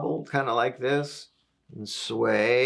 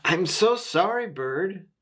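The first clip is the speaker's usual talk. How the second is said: high-pitched storybook apology; apologetic Rabbit, lightly voiced; acted apology; 6 semitones higher than usual. A narrator's reading. Rabbit to Bird after the branch broke, acted apology.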